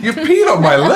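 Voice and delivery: high, loud outraged squeal through laughter; laughing mock outrage. Telling friends how his wife felt, laughing high and loud. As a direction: play the wronged victim cracking up.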